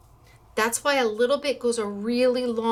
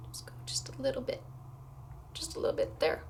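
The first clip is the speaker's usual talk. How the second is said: whispering; 4 semitones higher, 9 dB quieter, faster than usual. Real, not acted. Carefully pouring a drop.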